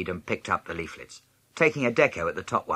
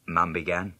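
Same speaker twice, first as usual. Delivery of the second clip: plain reading voice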